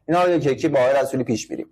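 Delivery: ordinary talk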